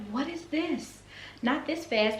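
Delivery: high, startled question; high disbelief at the email; surprised. Retold or imitated. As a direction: caught off guard by the email.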